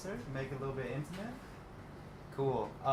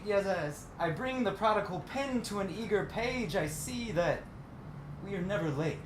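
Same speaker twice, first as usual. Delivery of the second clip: higher, rolling spoken-word delivery; poetry recital; 7 semitones higher than usual. Acted. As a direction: recite it as verse, each phrase rising then falling. Resumes reading the poem.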